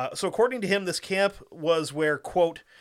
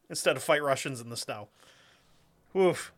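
normal talking voice

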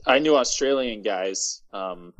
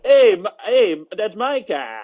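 high, exaggerated Chicago local; mock Chicago accent; 7 semitones higher, 3 dB louder, much slower than usual. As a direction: play an indignant Chicago car owner. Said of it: "That's what everybody sounds like".

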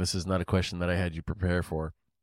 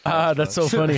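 laughing; 9 semitones higher, 7 dB louder than usual. Real, not acted. Laughing at guest's joke.

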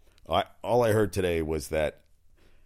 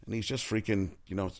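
ordinary delivery

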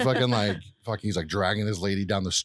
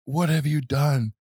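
slow, raised dramatic line; Obi-Wan; 7 semitones higher, 5 dB louder, slower than usual. Retold or imitated. Quoting Obi-Wan confronting Vader, slow and dramatically raised. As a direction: deliver it drawn out, higher and louder. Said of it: "fucking Obi-Wan's like".

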